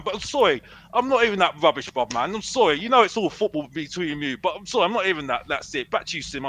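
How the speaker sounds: ordinary talk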